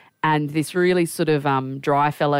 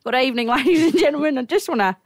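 put-on higher-pitched old fella on stage; little old MC; 8 semitones higher than usual. Old fella opening the event with comedy, mock stage voice. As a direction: open the night as a hopeful amateur comic. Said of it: "This little old fella gets up on stage".

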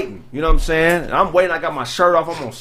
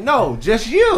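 slow suggestive reply; 5 semitones higher, much slower than usual. Retold or imitated. Photographer's creepy reply, retold.

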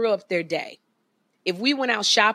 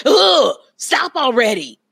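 loud, higher mock groan of protest; exasperated teenager; 6 semitones higher, 7 dB louder than usual. Mother imitating her son over baby pictures, loud exasperation. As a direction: imitate an embarrassed teen snapping back. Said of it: "He was like".

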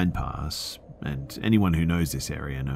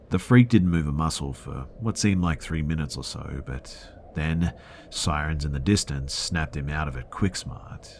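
ordinary delivery

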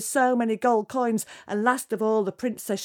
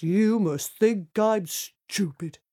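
slow, heavy giant voice; scornful giant; much slower than usual. Acted. Giant looks at the lying princess, slow scornful voice. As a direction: drag the words out scornfully.

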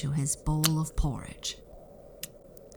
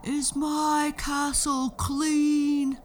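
slow, loud, much higher giant yell; 11 semitones higher, 4 dB louder, slower than usual. Acted. Giant checking his castle, slow loud yell. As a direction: drag out each word like a demanding giant. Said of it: "the giant would yell".

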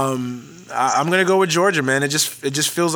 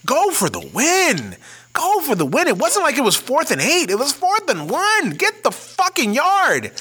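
much higher, pleading at the coach, drawn out; frustrated rant; 11 semitones higher, slower than usual. Real, not acted. Fuming at Oklahoma's coaches for kicking the field goal, high and exasperated. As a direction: yell at the TV for them to go for it. Ranting at Oklahoma's overtime call.

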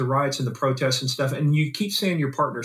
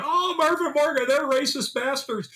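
high, drawn-out mock indignation; mock outraged accuser; 10 semitones higher than usual. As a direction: pitch it way up, imitating someone crying foul. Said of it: "Before anybody starts going".

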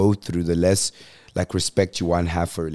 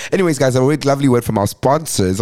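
radio announcer; 5 semitones higher, 5 dB louder than usual. Pretend. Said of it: "I'm joking".

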